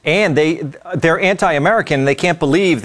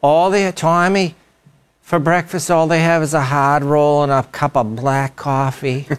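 complaining aunt. Retold or imitated. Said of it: "my aunt was like that".

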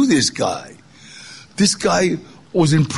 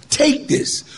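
ordinary talk, nothing marked

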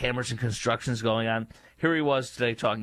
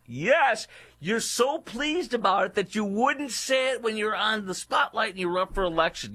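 high, mocking yes; sneering sarcasm at his pleased quote; sarcastic retort; 9 semitones higher than usual. Real, not acted. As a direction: drip with sarcasm.

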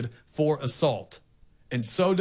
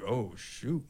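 small, soft, lower voice; worried kid; 4 semitones lower, 10 dB quieter than usual. Retold or imitated. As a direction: imitate a kid quietly realizing trouble. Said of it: "watch your kid perk up real quick like".

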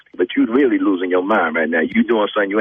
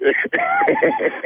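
a high, breathy burst of laughing; high laughter. Real, not acted. Caught in a morning-show prank, laughing high. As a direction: let the laugh burst out again.

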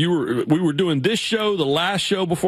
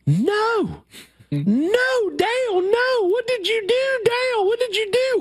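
much higher mock scream of a distraught fan; retold screaming fan; slower than usual. A fan at the fence screams at Dale, imitated high shrieking. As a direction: wail it like a fan who can't believe it. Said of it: "this woman started screaming".

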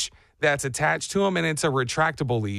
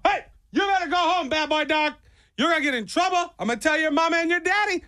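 very high, cutesy scolding voice; dog-scolding voice; 12 semitones higher than usual. Acted. Radio host on a bike telling off a loose dog, high-pitched scolding.

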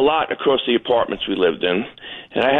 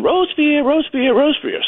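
cartoon mother duck calling her missing baby; 12 semitones higher than usual. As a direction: call for the lost duckling, high and searching. Mother duck calling lost duckling.

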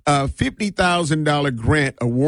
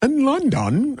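higher-pitched mock London accent; 9 semitones higher, slower than usual. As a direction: put on a playful London accent. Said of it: "like, you're from London".

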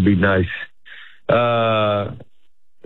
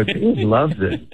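slow, drawn-out mock boast; slow tongue-in-cheek brag; slower than usual. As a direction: stretch it out as a proud report. Mock-reporting crowd reaction to his mom.

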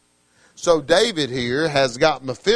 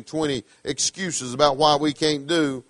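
usual speaking voice

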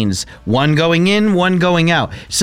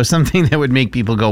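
usual speaking voice

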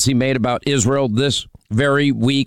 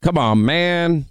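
higher, slow, drawn-out mock; mocking drawl; 4 semitones higher, slower than usual. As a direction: stretch it out to mock the caller. Host mocks caller's phrase, drawn out.

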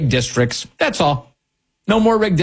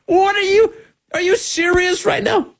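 incredulous. Real, not acted. Host reacting to Cleaver's claim, high incredulous. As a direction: squeal it in disbelief.

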